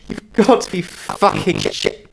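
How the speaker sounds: loud, high and scornful; angry outburst. Acted. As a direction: spit it out in contempt. Monster's outburst.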